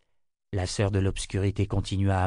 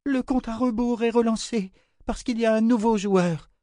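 very high, entranced woman's voice; delirious high-pitched announcement; deranged cry; 13 semitones higher, 4 dB louder than usual. A narrator's reading. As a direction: proclaim it high, swelling louder at the end. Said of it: "cria Ulissia".

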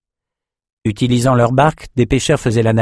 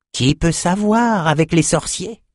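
high, slow teasing question; impish; 8 semitones higher than usual. A narrator's reading. Liès answering about the sorcerers, impish. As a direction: toss it off playfully, stretched and pitched up. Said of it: "répondit Liès mutine".

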